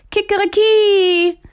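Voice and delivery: very high, slow, loud crow; rooster crow. A narrator's reading. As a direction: crow it long like a proud rooster. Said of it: "ruft aus Leibeskräften". (lang gezogenes Krähen)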